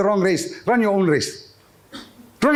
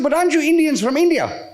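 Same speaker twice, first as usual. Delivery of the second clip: tourist woman; 7 semitones higher than usual. Retold or imitated. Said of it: "She said".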